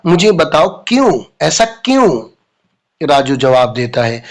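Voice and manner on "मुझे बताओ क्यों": ordinary talk, nothing marked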